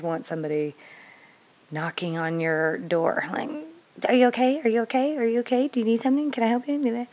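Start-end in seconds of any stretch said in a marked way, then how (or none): 4.1-7.1 high, pestering, repeated "okay?" on rising tunes; mock the helper who won't leave you alone; hammed-up high fusspot; hovering fix-it nag. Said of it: "that's just like Little Miss Fix-It".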